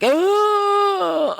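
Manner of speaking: loud, high-pitched shouted ugh of disgust. Retold or imitated. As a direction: recoil out loud. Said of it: "you're like".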